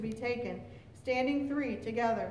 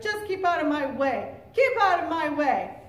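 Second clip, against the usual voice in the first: raised, louder angry warning; angry shout; 4 semitones higher, 7 dB louder than usual. A narrator's reading. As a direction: shout to drive someone off. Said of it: "grandfather shouted".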